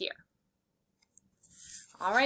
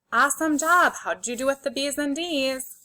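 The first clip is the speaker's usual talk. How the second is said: ordinary talk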